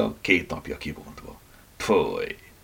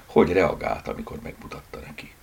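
plain reading voice

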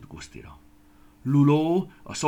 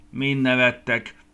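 slow, hurt, louder question; offended; 5 semitones higher, 10 dB louder, slower than usual. Acted. Guszti asks the laughing plums, slow and offended. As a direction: let the offence show in every word. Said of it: "kérdezte sértődötten Guszti".

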